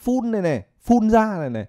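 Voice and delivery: "full" said high and mocking, as the wrong Vietnamese-style ending. Teacher mimics learners' wrong "full" ending, high and mocking. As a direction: imitate learners saying "full" for beautiful, pitched high. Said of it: "Có ai say rượu ở đây đâu mà full".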